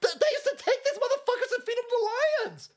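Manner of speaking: high whining complaint. Retold or imitated. Comic mocking Christians, high whining voice. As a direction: whine it like an aggrieved believer.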